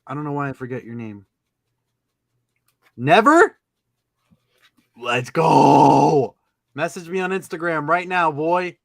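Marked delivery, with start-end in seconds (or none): 3.0-3.6 high, loud disbelief; surprised shout. Real, not acted. Surprised exclamation on stream. 4.9-6.4 loud celebratory yell; hype shout. Real, not acted.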